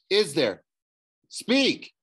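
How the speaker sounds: sharp rising command to the ghost; demanding. A narrator's reading. Boy demands an answer from the ghost, sharp command.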